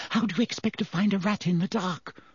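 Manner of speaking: a high, sharp snap of impatience; snapping. A narrator's reading. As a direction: cut him off, irritated. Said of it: "snapped Hermione".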